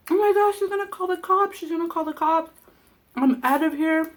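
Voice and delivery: high-pitched imitation of a panicked mother; mock panic. Daughter mimics her mother fleeing the cops threat, mock panic. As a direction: start high and loud, easing slightly.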